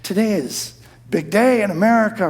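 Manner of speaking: ordinary talk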